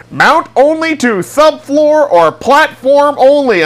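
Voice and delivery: high, slow, pompous reading; mock instruction voice. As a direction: read it like a stern warning label.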